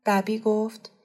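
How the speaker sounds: usual speaking voice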